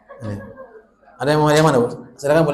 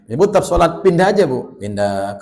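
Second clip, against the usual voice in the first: ordinary talk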